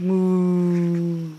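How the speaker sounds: long, even moo; drawn-out cow sound. Shown as a demonstration. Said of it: "Do the cow again".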